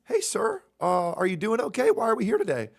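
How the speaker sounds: high, chirpy mock paramedic. Paramedic asking the patient, in a mock high voice. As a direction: squeak it out like an overly perky medic. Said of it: "and they are saying".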